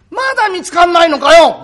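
loud, high, impatient scolding; じれた女房の小言. Acted. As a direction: nag the husband at full voice. 女房が亭主を責める. (じれったそうに声を張って責める)

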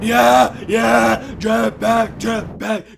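loud, gleeful shouts; excited cheering. Pretend. Player grabs a jetpack, excited cheering. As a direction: whoop over the prize.

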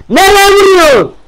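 high, loud exasperated yell; angry shout. Real, not acted. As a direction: bellow in disbelief. Angry at bad shot.

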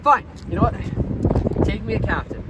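ordinary talk

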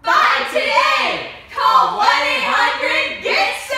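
booming slow infomercial yell; loud slow shout. Acted. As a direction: shout it slowly like a hard sell. Infomercial call to action.

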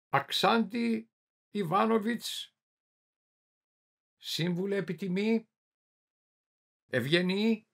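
high drawn-out summons in pieces; calling out. A narrator's reading. Inquisitor calling the hidden man by his titles, calling out. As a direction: call out name and titles, pausing between them. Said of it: "Μετά φώναξε".

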